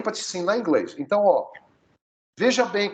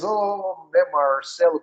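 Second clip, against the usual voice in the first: put-on Goiás countryside accent with curled R; interior Goiás accent; 3 semitones higher, much slower than usual. Teacher jokes about Goiás, imitated countryside accent. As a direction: imitate a rural Goiás speaker, stretching the R. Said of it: "ou então interior de Goiás".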